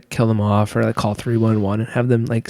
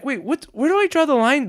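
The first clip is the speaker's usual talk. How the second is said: incredulous; 12 semitones higher than usual. Retold or imitated. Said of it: "I was like".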